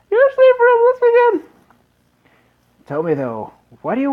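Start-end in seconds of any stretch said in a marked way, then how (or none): 0.1-1.5 put-on very high, louder falsetto girl; sister's voice. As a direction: squeak it as the little sister. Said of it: "she had the voice back then".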